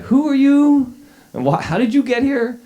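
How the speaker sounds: high, loud baffled disbelief; incredulous journalists. Retold or imitated. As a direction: demand it like a puzzled reporter. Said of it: "all the real journalists were like".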